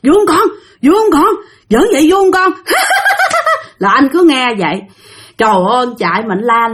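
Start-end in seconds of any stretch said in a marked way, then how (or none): 0.0-2.5 high-pitched eerie teasing; ghost mother's taunt. Retold or imitated. Ghost mother asks her child if teasing is fun, high and taunting. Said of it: "bà hỏi con bả là có vui không". 2.6-3.7 piercingly high mock ghost cackle; ghostly laughter. Ghost mother and child laugh as he runs, shrieking high cackle. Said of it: "nghe tiếng cười của hai mẹ con nó".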